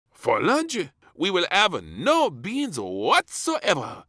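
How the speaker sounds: high, loud, slow grand announcement; announcing chef. A narrator's reading. Chef announces lunch without beans. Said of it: "announced the chef".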